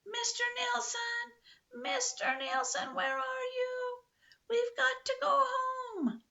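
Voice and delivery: high, slow drawn-out calling; shrieking call. A narrator's reading. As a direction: call far across the woods. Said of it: "shrieking at the top of her voice".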